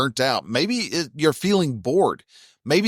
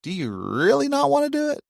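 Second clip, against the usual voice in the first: much higher, a small questioning inner voice; a lightly voiced, higher inner brain; 13 semitones higher, faster than usual. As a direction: let your little brain pipe up and ask. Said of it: "my little brain goes".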